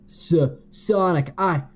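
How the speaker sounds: high stammered yell; hammed-up climax stammer; stuttering yell. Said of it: "Shadow yelled".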